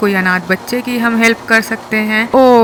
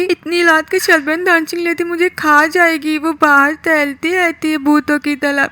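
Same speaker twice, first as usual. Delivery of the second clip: put-on higher, begging little boy voice; pleading kid Kroots; 5 semitones higher than usual. Kroots begging his aunt not to kick him out, higher pleading kid voice. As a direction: beg the aunt not to throw you out.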